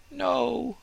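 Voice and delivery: high, slow, proud tree voice; proud fir tree. Acted. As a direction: speak as the vain young fir.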